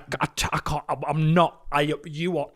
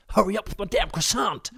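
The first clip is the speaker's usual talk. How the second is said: higher-pitched caricature American customer; American accent; 5 semitones higher than usual. Retold or imitated. American in a French restaurant demanding food, brash American accent. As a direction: demand it like an impatient American diner. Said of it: "Americans say croissant".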